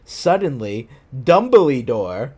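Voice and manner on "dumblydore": "Dumbledore" said as Dum-bly-dore; slow "Dumbledore" with an extra ee: DUM-buh-lee-dor; misspelling read aloud. Shown as a demonstration. Reader showing the fanfic's spelling, "Dumbledore" as Dumbly-dore. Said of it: "is how they spelled it".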